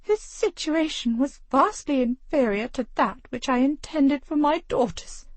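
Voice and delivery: high, prim and disdainful; clipped superiority, lips pinched tight; haughty lady. Acted. The haughty mother justifies herself to her husband, prim and high.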